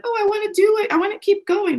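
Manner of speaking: high eager pleading voice; eager girly voice. Retold or imitated. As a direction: beg to keep going, high. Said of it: "where she's like".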